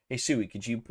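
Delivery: ordinary delivery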